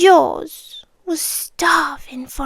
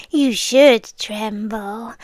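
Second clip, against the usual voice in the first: usual speaking voice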